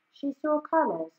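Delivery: ordinary delivery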